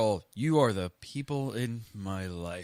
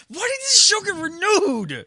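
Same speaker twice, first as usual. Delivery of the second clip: high, loud indignant cry; shrieking disbelief; mock outrage; 11 dB louder than usual. Real, not acted. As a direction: wail it high in protest. Outraged rhetorical question on cancellation.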